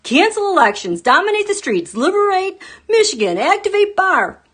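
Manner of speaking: high, loud mocking rally chant; quoting a crazy post in a high shouting voice; mock ranting slogans. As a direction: bark the slogans like a rabble-rouser. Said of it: "She made a comment".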